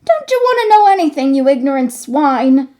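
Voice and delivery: high, fast, loud contemptuous jeer; scornful scolding. Acted. Hunchback boy scorning ignorant companions, high angry jeer. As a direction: pour scorn on them, high and quick.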